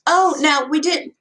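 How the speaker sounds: high, sweet, syrupy protest; syrupy widow. A narrator's reading. Young widow Miss Pickerel soothing the father, syrupy and high. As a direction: soothe them like sticky Karo syrup. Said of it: "Miss Pickerel's quiet Karo syrup voice".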